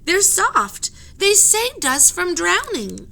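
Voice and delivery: high, loud, slow plea; pleading cry. Acted. Annie pleads with the sailors, high, loud and slow. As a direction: plead the octopus's case. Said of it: "cried Annie".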